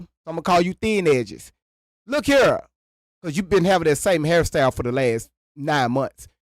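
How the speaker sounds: normal talking voice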